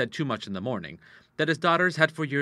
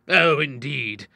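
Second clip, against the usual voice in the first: sarcastic; 3 semitones higher, 5 dB louder, much slower than usual. A narrator's reading. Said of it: "the doctor laughed sarcastically".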